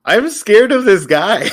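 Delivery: loud, high, drawn-out wail; exclaimed fear. Real, not acted. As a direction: cry it out slowly, playfully over the top. Player blurts out mock-fearful reaction.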